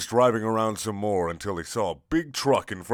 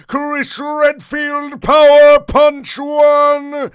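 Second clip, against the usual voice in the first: much higher, much louder, very slow drawn-out battle cry; screamed attack name; 14 dB louder, much slower than usual. A narrator's reading. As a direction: scream it like an anime special move. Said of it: "and then he screamed".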